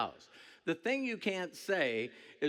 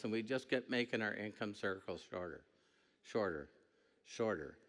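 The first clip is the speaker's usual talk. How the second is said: fading repetition; 6 semitones lower, 6 dB quieter than usual. Acted. Circle shrinking, voice fading.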